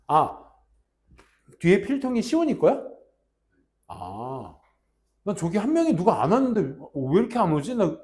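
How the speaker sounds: loud, high, emphatic class talk; teacher calling to class. Real, not acted. Teacher asking class who is missing, loud projected voice. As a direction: project over the room. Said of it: "teacher addressing students now".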